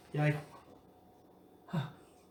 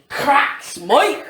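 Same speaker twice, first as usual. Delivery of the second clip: shouted; 9 semitones higher, 6 dB louder than usual. Acted. GM calls attack.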